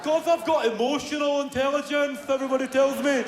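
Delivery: put-on high, daft kid voice; mock dunce child. Dunce child boasting of emotional intelligence, high mock voice. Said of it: "telling a child he's a fucking dunce".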